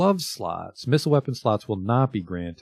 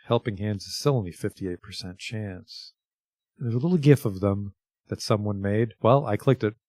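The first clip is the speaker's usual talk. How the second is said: usual speaking voice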